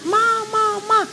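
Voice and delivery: mock yell for Mama, pitched high; retold yelling for mother. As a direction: imitate crying out in sleep. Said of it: "Saya teriak-teriak".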